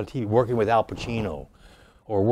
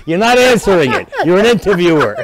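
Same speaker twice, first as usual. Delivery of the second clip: much higher and louder, urging everyone to keep rolling; excited director; 9 semitones higher, 8 dB louder than usual. Retold or imitated. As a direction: shout like a thrilled director who won't stop the take. Director shouting to keep filming.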